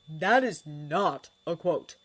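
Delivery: firm declaration. A narrator's reading. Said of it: "Harry declared".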